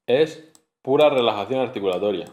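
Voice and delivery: usual speaking voice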